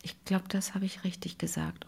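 very soft near-whisper; hushed aside. Real, not acted. Co-host claims she said it right, hushed aside. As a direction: murmur it under your breath.

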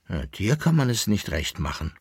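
ordinary delivery, nothing marked

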